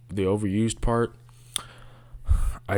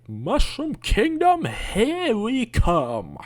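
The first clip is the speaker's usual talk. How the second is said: much higher, slow mocking of the movie line; mock the flat movie delivery; mock Chris Pratt Mario; 14 semitones higher, slower than usual. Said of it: "Mario wouldn't say".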